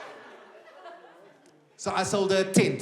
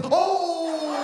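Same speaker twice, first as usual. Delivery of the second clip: shocked gasp; 9 semitones higher than usual. Retold or imitated. Comic reacts to granny's insult with a shocked shout. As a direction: react like you were just insulted. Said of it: "that was my response".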